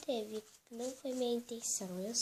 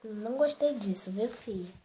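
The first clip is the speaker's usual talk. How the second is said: put-on firm, louder scolding of a naughty child; pretend scolding; 8 dB louder than usual. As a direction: tell the little girl off like a stern mom. Mãe de brincadeira repreende a filha-boneca. (bronca de mãe de brincadeira, mais alta)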